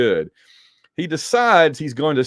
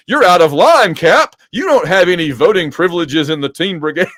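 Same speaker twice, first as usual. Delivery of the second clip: loud, raised mock telling-off; indignant rebuke; 4 semitones higher, 6 dB louder than usual. Rick overruling Cap at a Teen Brigade vote, indignant rebuke. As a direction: call him out of order, loudly.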